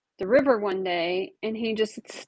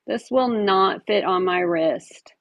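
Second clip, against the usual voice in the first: normal talking voice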